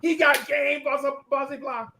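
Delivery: put-on high gushing teen girls. Friends cooing over the boy, high pitched. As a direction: coo over him. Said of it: "All her girls laughing like".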